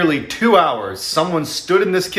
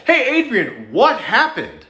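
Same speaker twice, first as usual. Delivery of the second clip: mild mock yell; 7 semitones higher, slower than usual. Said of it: "his mom from downstairs yell".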